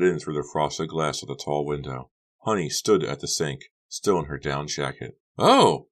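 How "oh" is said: loud, high "Oh" of surprise; startled cry. A narrator's reading. Becca finding Honey at the sink, startled cry. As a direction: cry out as if caught off guard. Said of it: "Becca cried out".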